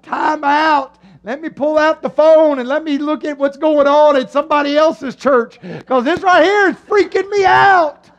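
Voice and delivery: loud, high, building call for time out; hammed-up panicky objection, rising toward the end; mock freaked-out protest. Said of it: "You're like".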